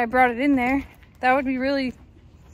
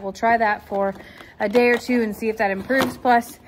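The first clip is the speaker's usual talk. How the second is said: normal talking voice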